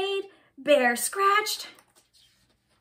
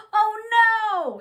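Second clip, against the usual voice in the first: high, loud storybook cry; dismayed scream; 7 semitones higher, 8 dB louder than usual. A narrator's reading. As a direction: wail it out for the lost kite. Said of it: "screamed Mole and Bear".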